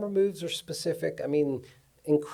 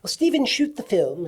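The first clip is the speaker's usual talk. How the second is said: higher, louder imitation of an English director; 8 semitones higher, 5 dB louder than usual. English director telling his cameraman to shoot, lightly imitated English voice. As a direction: lightly mimic an Englishman giving easy instructions. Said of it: "he goes like this".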